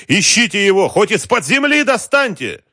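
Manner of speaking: very high, loud, raging command; furious order. A narrator's reading. Boss ordering men to find the truck, furious. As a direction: scream the order down the line. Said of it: "едва не задохнулся от гнева".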